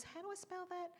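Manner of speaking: high, cheeky, innocent-sounding query; mock innocent. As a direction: ask as if helpfully taking dictation. Innocently asking to spell curses.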